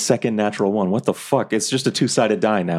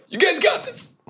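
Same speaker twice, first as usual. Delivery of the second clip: breathless, high, strained encouragement; winded, gasping out the words; slower than usual. Pretend. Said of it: "Through, like, my winded voice".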